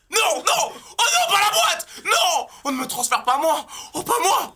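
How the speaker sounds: high-pitched panic cries; panicked lion. Acted. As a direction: wake up trapped and frightened. Dubbing panicked lion in crate.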